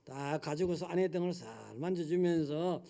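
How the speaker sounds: usual speaking voice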